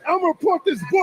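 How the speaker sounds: high-pitched, heated threat; angry threat. Real, not acted. Threatening to report the place, angry. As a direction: threaten them in the middle of a row. Threat during shouting match.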